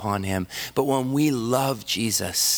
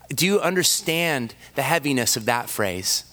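usual speaking voice

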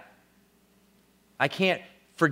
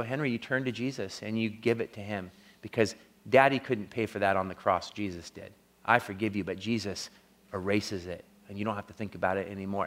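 low, soft retold consolation; tender fatherly reassurance; 6 semitones lower, 6 dB quieter than usual. Father telling his son he is forgiven, tender and low. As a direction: quietly reassure a child, voice kept down.

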